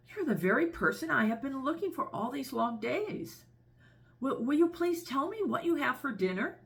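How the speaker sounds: put-on high, breathless, eager child voice. Elephant's child kneels to the crocodile, high and breathless. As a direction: ask eagerly, pitched high, as if panting. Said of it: "grew all breathless and panted".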